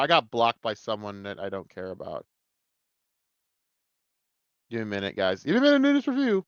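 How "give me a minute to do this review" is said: high, squeezed-fast mock voice; silly put-on voice. Reviewer asking for a minute, silly put-on voice. Mock repeat of own request.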